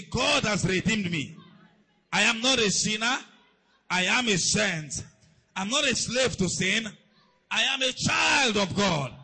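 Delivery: loud, slow, emphatic proclamation; shouted declaration. Real, not acted. Preacher has the church declare they are righteous, shouting emphatically. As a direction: shout each line for the congregation to repeat.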